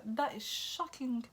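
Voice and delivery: slow, high, drawn-out shock; reacting to the mother's threat, slow and scandalised. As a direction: stretch it out, scandalised. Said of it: "I was like".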